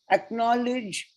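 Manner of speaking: usual speaking voice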